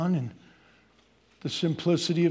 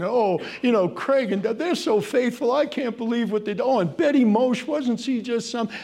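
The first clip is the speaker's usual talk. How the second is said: lightly voiced admiring churchgoer, higher and louder; gushing churchgoer; 7 semitones higher, 4 dB louder than usual. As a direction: gush about the faithful neighbours. Mimics admiring churchgoer's gossip.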